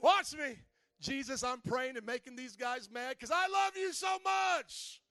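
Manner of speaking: loud, bold declaration of faith; defiant Daniel. Retold or imitated. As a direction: shout your defiance proudly. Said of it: "This is how I think Daniel did".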